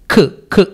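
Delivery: ఖ్ as a bare, short k sound; halant consonant. Shown as a demonstration. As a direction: say ఖ్ without an aa after it. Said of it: "హలంతు ఉన్నట్టుగా ఊహించుకుని". (ఖ్ పొల్లుగా పలకండి)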